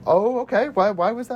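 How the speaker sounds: high, put-on polite surprise; high-pitched awkward reply; taken-aback date. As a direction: play the date politely startled. Plays the date's taken-aback reply.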